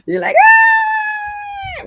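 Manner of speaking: high loud mock scream; mock shriek. Teasing a viewer about bugs in hair, mock shriek. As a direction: shriek like a bug's in your hair.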